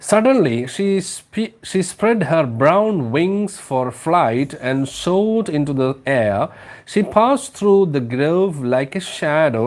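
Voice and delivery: slow, lilting storybook read-aloud; slow story reading. Acted. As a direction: read it slowly, savouring each phrase. (പതുക്കെ കഥ വായന)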